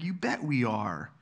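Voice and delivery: slow, dry sarcasm; sarcastic. Retold or imitated. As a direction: drag it out, clearly sarcastic. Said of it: "in a very sarcastic way".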